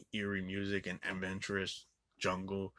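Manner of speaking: usual speaking voice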